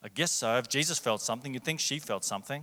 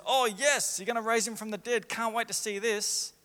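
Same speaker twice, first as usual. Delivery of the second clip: higher, gushing pretend delight; mock eagerness; 8 semitones higher than usual. Preacher voices the response Mary never gave, mock eagerness. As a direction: play an over-keen yes, tongue in cheek.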